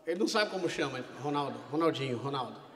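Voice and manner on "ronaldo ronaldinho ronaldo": low, soft confused mumble; nervous mumble. Retold or imitated. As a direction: fumble his own name nervously.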